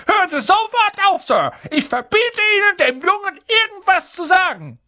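very high, loud, rapid order; commanding shout. A narrator's reading. Uncle forbids the giant to tell the boy, commanding shout. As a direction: forbid it in a panicky, high rush. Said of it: "befahl er".

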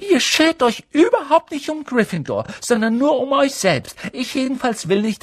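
shrill furious schoolgirl; hissing like an angry goose. A narrator's reading. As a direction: scold them like an angry goose. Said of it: "fauchte wie eine wütende Gans".

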